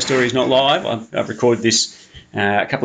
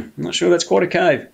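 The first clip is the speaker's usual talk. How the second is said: usual speaking voice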